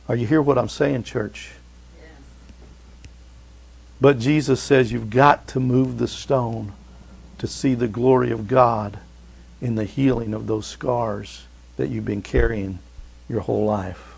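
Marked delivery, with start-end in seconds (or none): none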